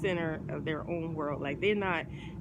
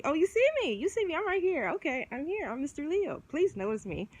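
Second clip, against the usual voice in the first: higher, showy mock Leo begging for attention; show-off Leo; 9 semitones higher than usual. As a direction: ham it up as the Leo on stage, pitched up. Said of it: "get on that stage and see".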